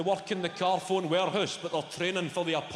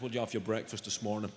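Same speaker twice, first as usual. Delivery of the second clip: lower, faster mock gym trainer; gym guy voice; 8 semitones lower, 4 dB quieter, faster than usual. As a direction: lecture him like a meathead trainer. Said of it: "He goes".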